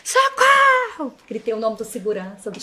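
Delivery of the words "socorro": high, slow drawn-out cries; retold scream for help. The delegate shouts for the guards at her condo, high drawn-out retold yell. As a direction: replay her own cry for help, much higher. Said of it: "eu comecei a gritar".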